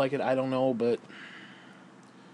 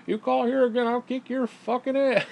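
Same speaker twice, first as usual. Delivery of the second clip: mock tough-guy threat; 9 semitones higher than usual. Quoting the man threatening the ex-husband, hammed-up. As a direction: ham up a macho threat in a squeaky voice. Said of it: "he said it with such conviction".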